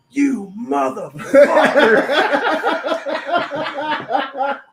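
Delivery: a long, very slow, high loud yell, laughing; mock yell. Sister yelling from the bathroom, retold as a laughing drawn-out yell. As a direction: stretch "You motherfucker" out into a laughing howl. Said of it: "the first thing I heard was".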